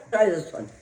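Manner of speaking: ordinary delivery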